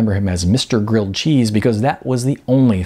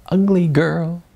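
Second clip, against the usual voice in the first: put-on high, taunting "Ugly Girl"; sing-song it like jeering birds; taunting chorus; 8 semitones higher, slower than usual. A narrator's reading. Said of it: "the birdies piped up with another chorus".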